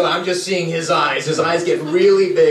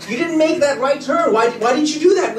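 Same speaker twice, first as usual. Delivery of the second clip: higher, mildly acted complaint; exasperated protest; 9 semitones higher than usual. Passenger to driver who missed the alley, mildly exasperated.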